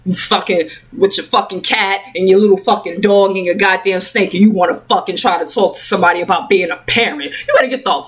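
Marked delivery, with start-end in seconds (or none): none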